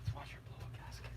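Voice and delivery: conspiratorial whisper. Real, not acted. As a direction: whisper it as a sly aside. Whispered aside.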